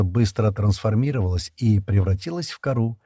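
ordinary delivery

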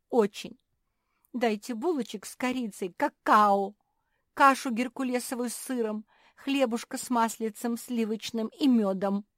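high, loud, pleading whine; whining. A narrator's reading. Naretta listing the breakfast she wants, whining. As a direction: beg for each treat in turn. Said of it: "заныла Наретта".

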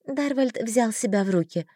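ordinary delivery

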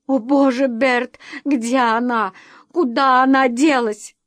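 frantic mother near tears, acted; tearful panic. A narrator's reading. Mother begging husband to say where daughter is, tearful panic. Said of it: "Её глаза заблестели от слёз".

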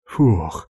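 short puff of relief; exhaled phew. A narrator's reading. Hiro catching breath after beating the thugs, exhaled phew. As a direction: let the breath out after effort. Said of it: "выдохнул Хиро".

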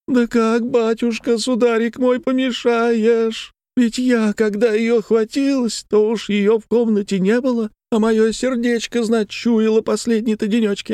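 very high wailing lament; keen through the tale as if weeping; high plaintive sing-out of grief; tearful woman. A narrator's reading. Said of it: "слезливым голосом".

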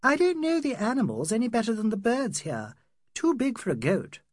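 put-on high-pitched young female character; Adela's voice. Adela answering what animal it was, high-voiced.

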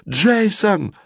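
very high cry of a name; panicked scream. A narrator's reading. Girl tumbled into basket, screams high for boy. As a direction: cry out desperately for your friend.

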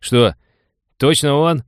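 high, incredulous question; startled double-check; taken aback. A narrator's reading. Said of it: "опешил Сальник".

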